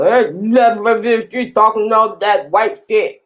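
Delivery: garbled, marble-mouthed mocking voice; mock mumbled heckler; mouthful of rocks. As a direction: slur it like talking through a mouthful of rocks. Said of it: "sound like they're talking to you with a mouthful of rocks".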